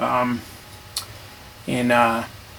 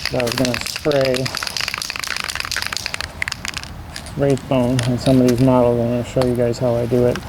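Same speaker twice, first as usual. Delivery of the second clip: muffled behind a respirator mask; through N95 mask. Real, not acted. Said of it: "I don't know if you can hear me with the mask on".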